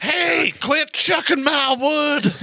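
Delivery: high, slow cartoon critter shout; hammed-up woodchuck outrage; mock woodchuck yell. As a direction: squeal it like an indignant woodchuck.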